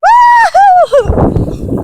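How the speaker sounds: a high, loud joyful woo-hoo; excited whoop. Real, not acted. Walking barefoot onto the beach for viewers, excited whoop.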